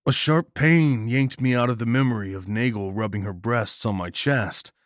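ordinary delivery, nothing marked

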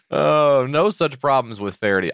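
normal talking voice

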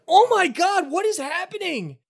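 high panicked gasp; horrified shriek. Acted. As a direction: squeal at the sight of bodies. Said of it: "is like".

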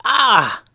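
high, loud cry of shock; acted "ah!" yelled high; startled yell. A narrator's reading. Ninja hit in the face by a pie, yelling. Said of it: "she yelled".

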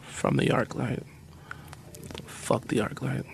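whispered aside, slowing; whispering. Real, not acted. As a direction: murmur it under the breath. Muttered confession about the ArcLight.

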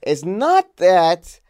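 high, loud, drawn-out mock-British proclamation; attempted British accent. Mad boss defending the wax museum, hammed-up British accent. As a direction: play an indignant boss in bad British. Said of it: "this is not a British accent".

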